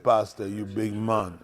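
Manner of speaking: low, slow, soft Jamaican cook; lightly voiced Jamaican man. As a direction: drop into the cook's voice.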